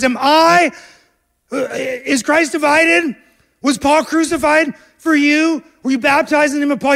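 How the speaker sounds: high, loud outraged yell; Paul shouting at the divided church; screaming Paul. Acted. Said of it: "He's screaming at him".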